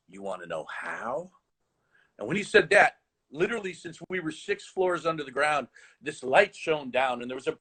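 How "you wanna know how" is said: low teasing teacher voice. Retold or imitated. Teacher tempting his eager student, low and hushed. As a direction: lower and quieter, drawing him in. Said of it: "He goes".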